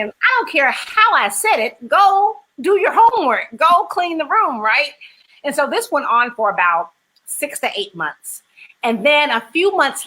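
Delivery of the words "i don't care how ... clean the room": exasperated aunt. Retold or imitated. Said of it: "And I'm thinking".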